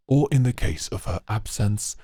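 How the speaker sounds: ordinary delivery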